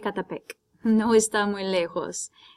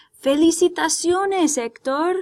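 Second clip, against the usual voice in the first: higher, louder bright congratulation; cheerful congratulation; 8 semitones higher, 4 dB louder than usual. Acted. Reporter congratulating the champion Héctor, excitedly. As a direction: cheer him on for his win. Reading the reporter's line aloud.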